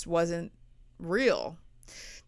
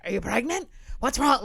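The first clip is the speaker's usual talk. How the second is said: higher, louder fretting dad; mock alarmed old dad; imitating worried dad; 5 semitones higher, 5 dB louder than usual. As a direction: do the panicky father. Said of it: "my dad was always like".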